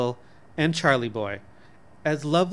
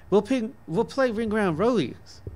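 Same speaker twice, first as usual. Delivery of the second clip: put-on excited child; 8 semitones higher than usual. A narrator's reading. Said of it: "clapping her hands".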